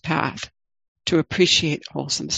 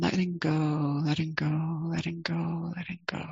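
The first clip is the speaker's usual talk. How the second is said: each letting go softer until only breath; fading to a whisper; 8 dB quieter than usual. Shown as a demonstration. Teacher modelling the out-breath, fading into a whisper. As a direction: let the words dissolve into an exhale. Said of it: "the emphasis can be on the out-breath".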